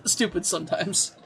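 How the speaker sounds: ordinary talk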